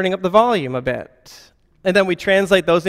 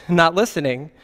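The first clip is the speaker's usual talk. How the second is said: normal talking voice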